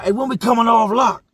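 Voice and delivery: loud, high call down the pod; yelling out. Retold or imitated. Inmates on lock calling to the guard, loud yell. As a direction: shout it from your cell door. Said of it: "We be yelling out".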